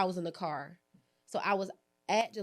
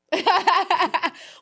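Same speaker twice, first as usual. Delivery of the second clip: loud burst of laughter; 12 semitones higher, 13 dB louder than usual. Real, not acted. Laughing at her own joke.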